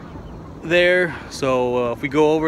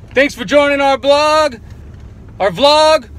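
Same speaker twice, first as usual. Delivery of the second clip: cheery outro; 11 semitones higher, 7 dB louder, slower than usual. Real, not acted.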